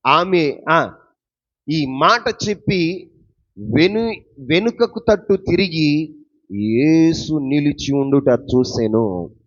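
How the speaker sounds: loud, slow, drawn-out verse reading; ringing pulpit reading, very deliberate; scripture declamation. Acted. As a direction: proclaim the verse at full volume, stretching the words. Reads scripture aloud, declaiming.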